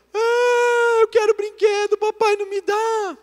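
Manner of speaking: high, loud wailing imitation of a little girl; crying child. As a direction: mimic a toddler crying out a complaint to mum. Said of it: "Ela chegou chorando".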